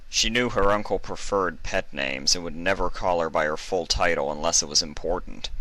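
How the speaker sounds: ordinary delivery, nothing marked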